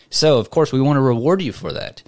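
ordinary talk, nothing marked

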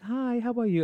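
high, light greeting; friendly colleague. Retold or imitated. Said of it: "she'd just be normal".

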